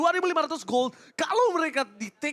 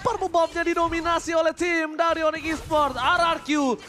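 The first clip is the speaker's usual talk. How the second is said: esports caster; 6 semitones higher than usual. Acted. Live play-by-play.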